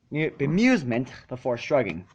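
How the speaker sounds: plain reading voice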